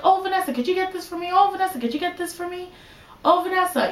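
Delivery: high-pitched, repetitive mock summons; imitated parent calling. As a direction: mimic a parent calling out for a favor. Parents calling their daughter for favors.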